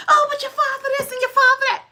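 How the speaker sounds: fast, high nagging whine; mother's complaint. Retold or imitated. Daughter mimics mother repeating old grievances, fast high whine. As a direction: rattle it off like a mother who won't let go. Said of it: "she'd be like".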